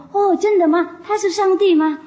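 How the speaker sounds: high, loud thrilled exclamation; excited chieftain. Retold or imitated. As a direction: gasp it out as if you've found your saviour. Drunk chieftain thrilled by master. (醉酒首领惊喜地高声发问)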